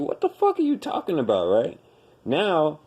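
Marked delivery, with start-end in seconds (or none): 0.0-1.5 incredulous quote. Retold or imitated. Everybody's dismissive reaction quoted.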